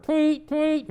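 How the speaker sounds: high chirpy tweet tweet; bird tweet. Acted. The toy bird on the tree chirps, high and bright.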